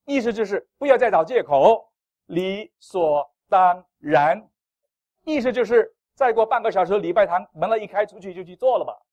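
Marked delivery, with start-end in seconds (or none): 2.2-4.5 理所当然 dragged out word by word, loud; emphatic slow. Acted. Pastor drives home 理所当然 to the church, slow and loud. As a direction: spell out 理所当然 slowly, each syllable weighted. Pastor hammers key phrase.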